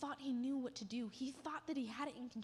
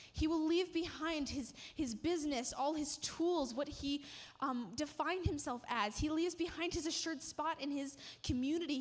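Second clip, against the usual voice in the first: rising, louder preacher's swell, higher pitch; building preaching; 3 semitones higher, 3 dB louder than usual. Acted. Preacher telling the congregation what Peter leaves behind, building passion. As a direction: build it like the sermon's climax.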